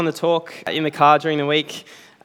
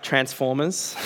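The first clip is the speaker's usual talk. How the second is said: ordinary talk, nothing marked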